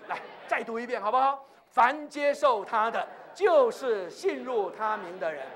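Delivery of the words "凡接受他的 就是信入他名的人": slow, measured recitation; leading scripture reading. Real, not acted. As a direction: read it out slowly for all to follow. Leads congregation reading scripture. (缓慢有力地领读经文)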